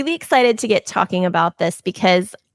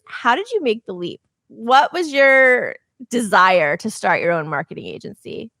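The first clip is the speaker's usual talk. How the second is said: ordinary delivery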